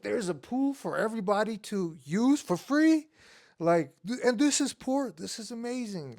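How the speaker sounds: high, wide-eyed disbelief; amazed kid. Retold or imitated. Young immigrant marveling at a free community pool, amazed. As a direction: gush over a free pool like a dazzled kid. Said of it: "I'm like".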